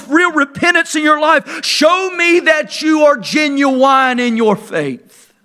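preaching at full force. Acted. Preacher to his congregation about the Pharisees, preaching at full force. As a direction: thunder it out like a fiery preacher.

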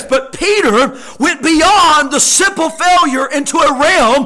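loud, ringing scripture reading with drawn-out key words; impassioned pulpit voice; full-force preaching. Real, not acted. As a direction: preach the verses hard to the congregation.

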